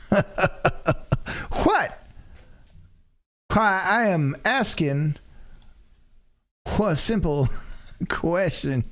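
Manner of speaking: a Southern gentleman feigning innocence; Southern lawyer uncle. Acted. The uncle voice protests.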